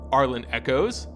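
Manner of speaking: usual speaking voice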